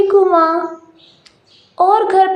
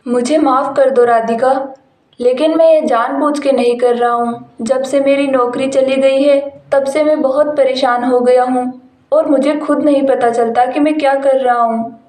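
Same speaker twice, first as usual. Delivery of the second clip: lowered male voice, sorry; apologetic husband; 4 semitones lower than usual. Acted. Husband apologises to his wife after losing his job, lowered male voice.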